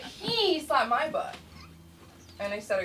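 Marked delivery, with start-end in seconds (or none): 0.0-1.4 high, slow indignant complaint; imitated schoolkid tattle; tattling kid. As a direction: draw it out like a child telling on someone. Imitates tattling second grader.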